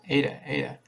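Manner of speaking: a touch of calling up the stairs; mild bawl upstairs. A narrator's reading. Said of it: "He bawled upstairs".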